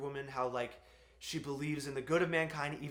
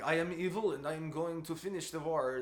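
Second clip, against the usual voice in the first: mock German villain accent. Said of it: "two-dimensional German character who was like".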